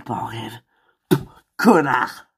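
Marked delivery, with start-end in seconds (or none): 1.6-2.3 angry. Acted. Insult after rejection.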